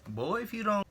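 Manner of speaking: mock threatening boy if you don't; mock warning. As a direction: warn him like an auntie. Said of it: "looking at his Timbs".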